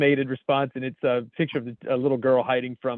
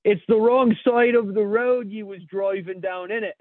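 loud, high, drawn-out mock English yell; shouted English accent; 7 semitones higher, 4 dB louder than usual. Cop shouting back at an English driver, mock English accent. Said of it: "while shouting".

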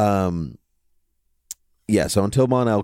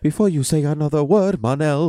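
high, overdramatic hero voice; hammed-up Mon-El; 9 semitones higher than usual. Podcaster acting out Mon-El's line for the cohost, hammed-up and high. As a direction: ham it up like a TV hero's big speech.

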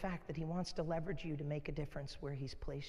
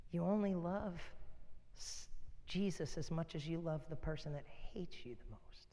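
softer whispered repeat of the point; hushed whisper; 6 dB quieter than usual. Real, not acted. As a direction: whisper it intimately to drive it home. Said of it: "repeated as hushed whisper".

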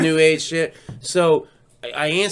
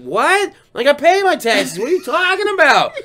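rising indignation; 10 semitones higher, 4 dB louder than usual. Retold or imitated. Arguing with scam caller.

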